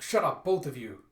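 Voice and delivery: loud, annoyed rebuke; irritated order. A narrator's reading. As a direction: silence the bickering pair. Ogress silences companions.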